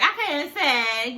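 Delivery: slow, lightly put-on coy voice; mock modesty. Mocking the singer's fake modesty, slow and coy. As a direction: mimic her fake modesty. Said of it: "at the end be like".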